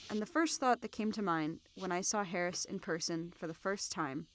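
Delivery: normal talking voice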